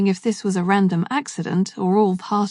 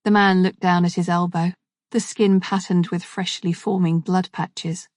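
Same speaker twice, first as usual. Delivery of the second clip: ordinary delivery, nothing marked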